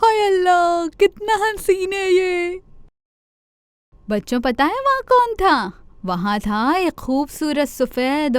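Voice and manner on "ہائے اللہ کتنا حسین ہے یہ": admiring gasp. Acted. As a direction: gasp in delight at something lovely. Chanda Mama admires the rabbit.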